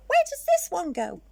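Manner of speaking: baffled skeleton; puzzled skeleton. Acted. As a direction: wonder where the bone goes. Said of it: "said the big skeleton".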